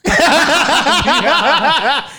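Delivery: high giggling laugh; high burst of laughter. Real, not acted. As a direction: crack up laughing. Laughing at own story. (carcajada aguda)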